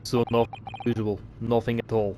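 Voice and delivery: put-on dismissive Russian accent; curt Russian accent. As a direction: brush it off like a Russian guard. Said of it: "I like to do Russian accents".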